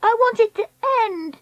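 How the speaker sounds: high, petulant wail; stamping tantrum. Acted. Treacle stamping her feet, high tantrum. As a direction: insist in a very high voice. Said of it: "She stamped her feet".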